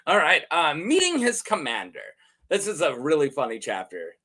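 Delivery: ordinary talk, nothing marked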